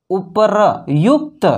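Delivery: उपरयुक्त sounded out slowly as u-pa-ra-yukt; slow spelled-out उपरयुक्त; syllable by syllable. Shown as a demonstration. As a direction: stretch उपरयुक्त into separate syllables, r before y. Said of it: "तो हम लोग अगर पढ़ेंगे इसको कि".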